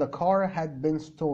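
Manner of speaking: the car had been stolen, slowly with a rise on car; slow repeat of the example; slow model sentence. Shown as a demonstration. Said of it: "ف had been مثلًا".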